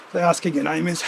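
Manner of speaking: ordinary talk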